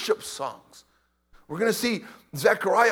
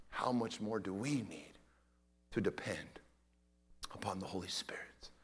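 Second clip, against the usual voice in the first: much softer, breathy hush; hushed preaching; 8 semitones lower, 10 dB quieter than usual. Acted. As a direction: lean in and whisper the point. Preacher hushes intimate point.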